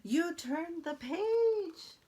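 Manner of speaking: high, very slow, loud shocked monster; dramatic Grover. Acted. Grover aghast the reader turned the page, slow and high. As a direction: drag out the horror of it. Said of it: "He's very dramatic".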